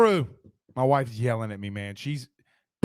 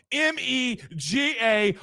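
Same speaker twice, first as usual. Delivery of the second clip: each letter slow and separate; M E G A spelled slowly, letter by letter; 6 semitones higher, slower than usual. Shown as a demonstration. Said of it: "all capitalized".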